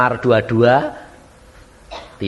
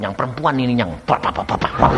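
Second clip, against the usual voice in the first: imitated ranting noise; 3 semitones lower, 4 dB quieter than usual.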